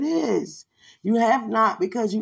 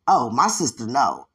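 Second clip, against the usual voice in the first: friend insisting, low; 9 semitones lower than usual. Retold or imitated. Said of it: "friend insists".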